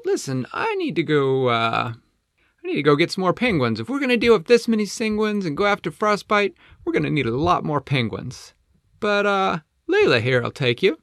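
high, loud, quick penguin; Flippo. Acted. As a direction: rattle off the plan in a perky penguin voice. Flippo plans to fetch penguins.

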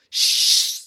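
sharp unvoiced "shh"; hushing shush. Finn hushes his excited sister, urgent shush.

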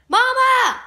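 high, loud call; shouting. A narrator's reading. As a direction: yell her name to stop her. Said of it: "Miguel shouted".

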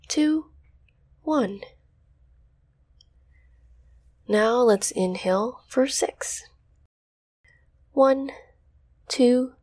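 guided meditation. Acted. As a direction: ease them into stillness. Counting breaths slowly.

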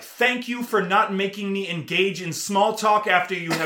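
loud, over-polite military recital; mock formal thanks. Recruit to superior after being torn down, mock formal.

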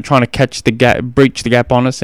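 ordinary talk

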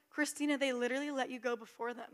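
soft, quick inner-voice nudge; retold whisper. Inner voice to annoyed driver, hushed reproof. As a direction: chide gently under your breath, still voiced. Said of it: "I just hear a whisper".

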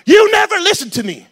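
high, loud mock accusation; accusing complaint. Spouse to partner who never listens, accusing. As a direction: throw it out as an exasperated spouse. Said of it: "That's how you talk".

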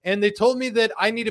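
hammed-up Canadian accent, high and loud, with "eh" tags; angry Canadian caller. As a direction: play an irate Canadian, raising the pitch. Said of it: "I got a call from an angry Canadian".

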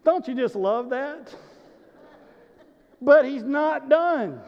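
ordinary talk, nothing marked

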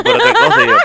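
laughing as he talks. Real, not acted. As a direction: break into laughter. Joke about burping, laughs.

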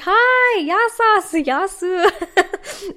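high, loud, bright greeting to passers-by; cheery taxi driver. Retold or imitated. The Greek taxi driver greets everyone while speeding: high, loud, cheery hellos.

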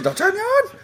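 high, rising call of D'Artagnan; mock villain call. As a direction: squeal the name up high. Echoing the same villain call.

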